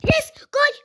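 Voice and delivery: high, loud tearful cheer; high cheer close to tears; crying-out cheer. Real, not acted. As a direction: cry out in relief at the goal. Finally scores a goal.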